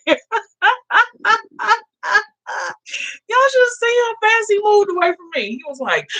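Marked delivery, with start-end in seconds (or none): 0.1-0.2 very high, laugh-filled voice; cracking up while telling it; real amusement. Real, not acted. Still laughing at man's retreat. 0.2-1.8 high, helpless laughing fit; long high-pitched cackling laugh; real amused laugh. Real, not acted. Laughing at man backing away. 3.5-5.5 very high, laugh-filled voice; cracking up while telling it; real amusement. Real, not acted. Still laughing at man's retreat.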